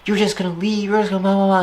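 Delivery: high mock-whiny girlfriend voice. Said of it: "one girlfriend at a time was like".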